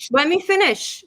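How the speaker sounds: high, firm interruption; insistent. Real, not acted. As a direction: raise your voice to hold the floor.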